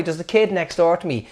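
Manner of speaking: ordinary talk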